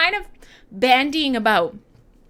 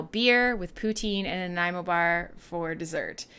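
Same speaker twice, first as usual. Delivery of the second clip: usual speaking voice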